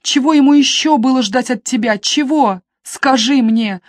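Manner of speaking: a sister demanding answers from her brother; shouting. A narrator's reading. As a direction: force him to answer.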